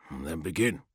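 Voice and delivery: soft, low mental voice; quiet thought sent into a mind; whispery telepathic voice. A narrator's reading. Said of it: "he sent".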